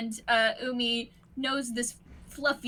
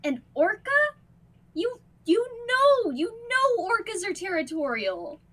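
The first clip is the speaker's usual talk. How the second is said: worried guardian; 9 semitones higher than usual. Acted. Kaito worried about the orca.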